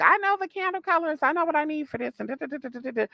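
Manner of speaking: high-pitched mimicry of a cocky student; mock her bragging up high, trailing into chatter; mocking know-it-all student. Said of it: "tell this owner, like".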